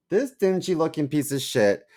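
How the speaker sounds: loud, high exasperated scolding; angry rant. Retold or imitated. Wife scolding husband about a rusty cabinet, loud and exasperated. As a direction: tear into him over the junk.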